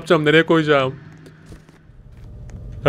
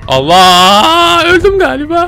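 loud cry, then much higher wail; startled scream; 10 semitones higher, 5 dB louder, slower than usual. Real, not acted. Gamer caught by the monster, startled cry. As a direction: cry out as you get caught.

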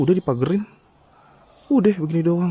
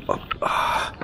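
effort grunt; 5 dB quieter than usual. Real, not acted. Climbing with camera and torch.

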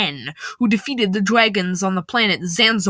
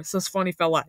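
plain reading voice

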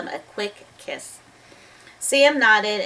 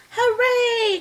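high, joyful cheer; a bright burst of joy; 4 dB louder than usual. Acted. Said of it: "cried Annie".

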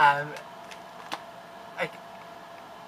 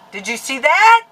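spooked; 9 semitones higher, 13 dB louder than usual. Real, not acted. Spooked by camera.

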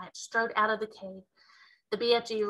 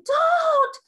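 high, loud scream of don't; a little girl's sudden scream; terrified girl screaming at the giant; 10 dB louder than usual. Acted. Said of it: "Sophie wanted to scream".

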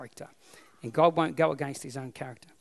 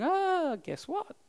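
amazed; 12 semitones higher, 4 dB quieter, much slower than usual. Retold or imitated. Peter amazed in the street.

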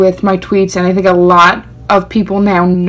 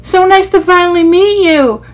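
excited; 11 semitones higher, 4 dB louder than usual. Retold or imitated. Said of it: "she hugs me and goes".

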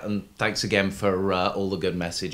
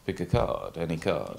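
theatrical magician; 5 semitones lower, 5 dB quieter, slower than usual. Pretend. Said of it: "say to me in your best magician's voice".

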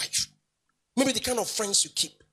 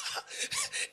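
short, high breathy laugh; amused laughter; 11 dB quieter than usual. Real, not acted. Preacher recalling sitting in class, laughing. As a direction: let out a quick high laugh.

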